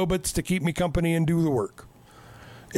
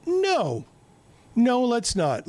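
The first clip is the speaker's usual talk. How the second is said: high-pitched refusal; 8 semitones higher, slower than usual. Retold or imitated. Crew refusing in retold scene.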